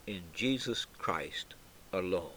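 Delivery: whispered close of the creed; hushed whisper. Acted. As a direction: whisper the last phrase. Creed climax drops to hush.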